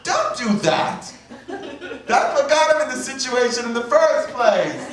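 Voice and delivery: high, slow, drawn-out mock scream; screaming hard-of-hearing grandmother. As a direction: holler like a grandmother who can't hear. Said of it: "she'd scream out".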